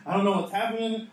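high, loud acted outcry; mock scream. Preacher as the panicked Daniel he imagines, mock scream. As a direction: cry out in pretend panic. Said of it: "Daniel should have been screaming".

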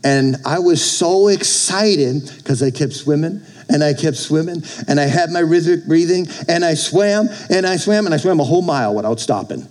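usual speaking voice

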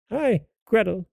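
put-on high-pitched old witch summons; witch calling. A narrator's reading. Witch calling the girl to work, high voice. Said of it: "she called to the girl".

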